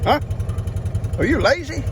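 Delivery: put-on high teasing baby talk to a horse. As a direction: coo it like you're talking to a pet.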